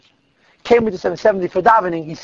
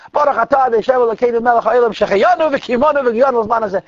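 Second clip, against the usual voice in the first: raised, full-voiced blessing; a mild retold cry of the blessing, higher pitched; loud and clear blessing; 5 semitones higher, 3 dB louder than usual. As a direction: proclaim it with your whole soul. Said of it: "says loud and clear with his whole neshama".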